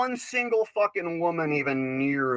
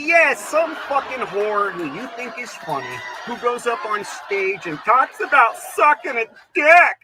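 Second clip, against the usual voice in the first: loud, high furious rant; ranting shout; 7 semitones higher, 6 dB louder than usual. Acted. Comic raging at the crowd, shouting. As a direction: bellow it with mock outrage.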